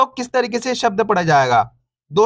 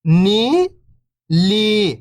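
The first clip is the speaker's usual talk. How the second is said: first syllable lifting, pause, second falling; slower than usual. Shown as a demonstration. Teacher breaking a word down for beginning readers, syllable by syllable. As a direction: sound out each syllable alone, long and separated. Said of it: "एक अक्षर का उच्चारण करके".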